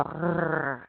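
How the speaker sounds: low growled "Rrrr" at the back of the throat; French growly R. Shown as a demonstration. Teacher shows students the growly bear trick, throaty French R. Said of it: "it's like being a French growly bear".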